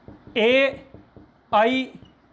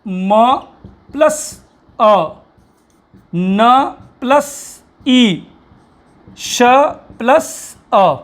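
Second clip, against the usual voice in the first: म प्लस अ, न plus ई spelled out very slowly, each part apart; teacher spells each sound out slowly for the class; slow syllable-by-syllable breakdown; 8 dB louder, much slower than usual. Shown as a demonstration. Teacher showing students how to split Manish, slowly. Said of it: "तो क्या करोगे आप?".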